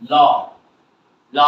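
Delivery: "ল" for lot, T left off; silent final T. Shown as a demonstration. Teacher repeats lot as "ল", final T silent. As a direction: say "ল" and stop before the T. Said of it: "টি হবে না".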